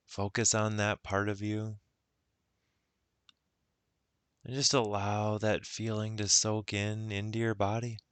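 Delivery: unhurried guided meditation voice. Acted. Guide leading listeners into self-love, guided meditation. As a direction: ease the listener inward, with long pauses.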